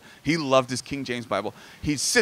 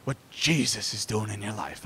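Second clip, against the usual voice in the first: grandpa's savouring delivery. Retold or imitated. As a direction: imitate grandpa, drawing out JESUS reverently. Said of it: "he would say it like that".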